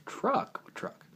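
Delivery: high, mock-innocent question; feigned surprise. Seller playing dumb about the truck, high and mock-innocent. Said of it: "And the guy goes".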